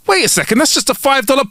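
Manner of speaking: high-pitched objection; puzzled protest. Acted. Lawyer noticing the wrong note, high and protesting. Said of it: "looks down at the note".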